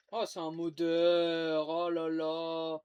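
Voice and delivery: slow, loud groan of despair; drawn-out dismay; exasperated. Real, not acted. Gamer exasperated at modder.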